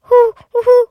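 loud, high owl hoot; hululement du hibou. Acted. Startled owl hoots. (hululement aigu et sonore)

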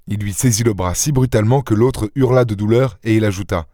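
ordinary delivery, nothing marked

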